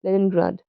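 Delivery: Leningrad, stressed first syllable, two light ones after; stress on LEN. Shown as a demonstration. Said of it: "A stressed syllable followed by two unstressed syllable".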